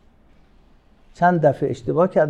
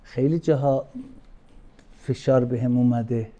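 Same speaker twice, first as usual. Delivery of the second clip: ordinary talk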